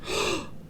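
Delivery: sharp shocked breath; gasp. A narrator's reading. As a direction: gasp in surprise. Reaction to the roar.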